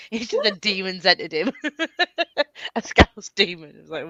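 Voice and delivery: ordinary talk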